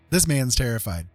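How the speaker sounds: ordinary delivery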